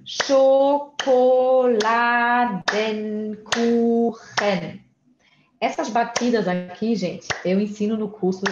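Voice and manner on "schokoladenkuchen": "Schokoladenkuchen" very slowly, one long syllable at a time; sílaba por sílaba. Shown as a demonstration. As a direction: stretch and separate every syllable of "Schokoladenkuchen". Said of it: "separe elas em sílabas". ("Schokoladenkuchen" bem devagar, separado em sílabas)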